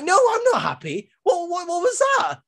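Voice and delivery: high-pitched bewildered rant at the wrestlers; exasperated disbelief. Retold or imitated. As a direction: squeak out your disbelief at the screen. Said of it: "And I'm like".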